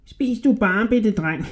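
high, squeaky, wheedling rat voice; sly little rat man. A narrator's reading. Little rat flattering a hungry Hodja, high and squeaky.

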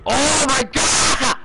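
long, high, drawn-out scream; anguished scream. Real, not acted. Player whose team just died, anguished scream. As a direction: wail it slowly at full volume.

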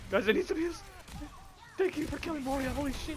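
high, urgent call for heals; excited. Real, not acted. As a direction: call out, keyed up. Fight, calling for help.

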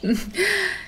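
short happy laugh. Real, not acted. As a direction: laugh fondly. Mother laughing fondly.

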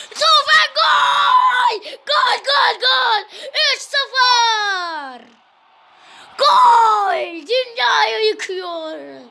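high, drawn-out shouted goal call; screaming football commentator. Acted. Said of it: "bağırabilmiş olabilirim".

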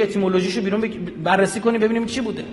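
ordinary talk, nothing marked

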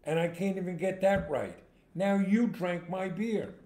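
exasperated sad sack. Acted. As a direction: build the woe to a punchline. Exasperated punchline of sad man's woes.

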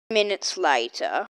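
low storyteller voice for the time skip; deep narrator voice. A narrator's reading. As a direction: drop way down like a narrator. Child narrates time skip in play.

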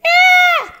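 piercing high scream; shriek. A narrator's reading. Annie shrieking at the dinosaur, a piercing high scream. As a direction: let out a sudden shriek. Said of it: "Annie shriek".

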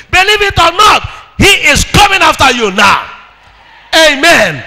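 loud, high preaching cry; rising shouted sermon; full-force preaching. Acted. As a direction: preach it out to the whole church.

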